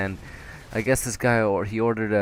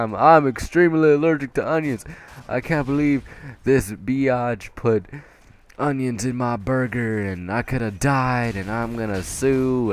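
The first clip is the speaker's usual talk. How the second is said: loud, raised-pitch customer complaint, easing slightly; mock angry rant; 4 semitones higher, 4 dB louder than usual. Customer with onion allergy threatening staff, mock-outraged rant. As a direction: imitate an outraged customer ranting.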